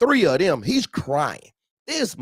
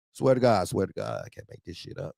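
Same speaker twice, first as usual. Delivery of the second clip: ordinary delivery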